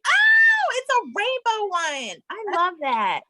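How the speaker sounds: loud, high-pitched squeal of delight; excited delight. Real, not acted. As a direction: burst out thrilled, way up high, then come down. Delighted at guest's rainbow outfit.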